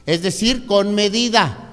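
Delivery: loud, high sermon delivery, counting out points; preacher building up to full force; preaching. Acted. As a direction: drive each point home to the congregation. Preaching three points of discipline.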